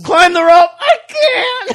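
high, loud tearful mock cry; mock wail. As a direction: wail it in pretend despair. Teasing about gym class.

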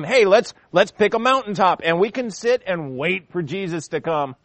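a scoffer ridiculing those awaiting Jesus; sarcastic scoffer. Retold or imitated. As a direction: sneer at the believers. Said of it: "Can't you just hear the sarcasm".

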